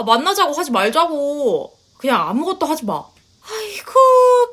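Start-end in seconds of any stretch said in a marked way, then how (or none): 3.4-4.5 long, high wailing cry of despair; a mother's theatrical wail of grief; wailing lament. Acted. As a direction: drag out a stretched, crying "아이고". Mother wails at her son's words.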